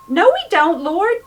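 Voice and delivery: high, loud, alarmed protest; Thomas protesting. Retold or imitated.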